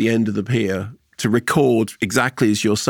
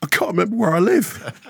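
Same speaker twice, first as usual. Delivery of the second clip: high tearful punchline; put-on sobbing voice, high-pitched; mock weeping; 7 semitones higher than usual. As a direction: wail it lightly as the crying old man of a joke. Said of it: "old bloke sitting on a bench crying".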